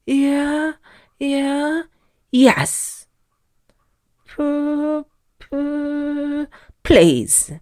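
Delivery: high, shaky nervous stammer; quivering stammer. A narrator's reading. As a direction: stutter out please while shaking. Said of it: "quivered and shook and said".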